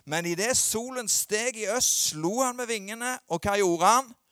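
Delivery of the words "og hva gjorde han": usual speaking voice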